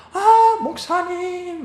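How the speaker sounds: high, drawn-out swooning "아, 목사님"; mock adoring sigh, slow and very high; fawning admirer. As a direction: gush over the pastor like a starry-eyed fan. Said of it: "막 이럽니다".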